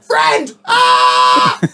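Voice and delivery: shouted, then a high yelp, playful dog; mock excited yell. The bulldog charging the spaniel, a mock happy shout. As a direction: bellow it like a bounding dog greeting a friend.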